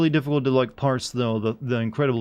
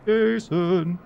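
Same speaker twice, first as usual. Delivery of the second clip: mock call, higher-pitched; 7 semitones higher than usual. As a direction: call "Jason" out as a game character would. Mock calling out from a game.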